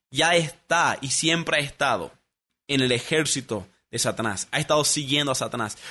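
usual speaking voice

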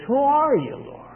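high-pitched, mildly fearful question; awed fear. Retold or imitated. Saul answers the voice from heaven, with fear and awe. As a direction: imitate an awestruck, frightened plea. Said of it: "filled with fear and terror and awe".

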